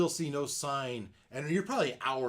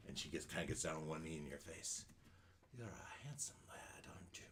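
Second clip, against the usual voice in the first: put-on old hag; 4 semitones lower, 14 dB quieter than usual. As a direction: hiss flattery like a crone. Hag flatters dwarf.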